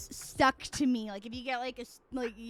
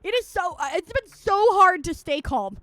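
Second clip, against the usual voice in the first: high, loud exasperation; pissed off; 8 semitones higher, 8 dB louder than usual. Real, not acted. Streamer admitting to viewers they can't stay calm, worked up. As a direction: vent it, struggling to stay calm. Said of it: "I just get pissed off again".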